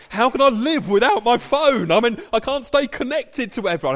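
high, loud, breathless mock panic over a lost phone. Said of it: "probably hyperventilating".

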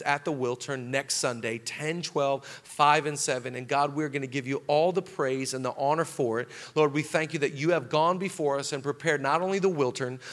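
low, even praying voice; public prayer. Real, not acted. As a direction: lead the congregation in prayer, building gradually. Closing prayer before the congregation.

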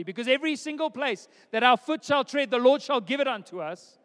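loud, high, hammed-up declaration; mock zealous proclamation. Christians claiming a property by scripture, loud mock proclamation. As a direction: proclaim it like an over-zealous believer.

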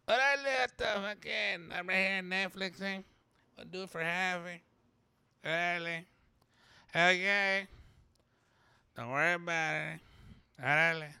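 lightly voiced Chicano comic, loose and teasing; a hint of a laid-back Chicano comic doing a sales pitch; George Lopez. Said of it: "thanks a lot, George Lopez".

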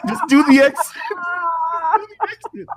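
can barely get it out for laughing. Real, not acted.

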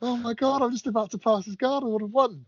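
high mock wail of a near miss; panicked inner voice. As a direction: squeak it out like you just lost the match. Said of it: "I was like... thinking".

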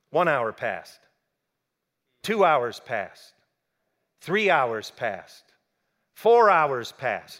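slow, each hour weighed down; slow counting of hours, heavy falls; slow dramatic count. A narrator's reading. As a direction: drag out every hour of waiting. Counting the long wait.

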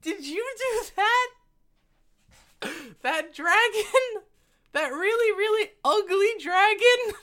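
put-on very high, slow, awed kid voice; wide-eyed child pointing at the dragon; little girl voice. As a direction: gasp it out like an amazed little girl. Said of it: "and she's like".

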